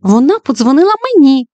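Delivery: put-on high, loud child exclamation; exclaimed. Jessica shouting to the class, high excited exclamation. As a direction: blurt it out like an eager girl. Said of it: "вигукнула Джесіка Фінч".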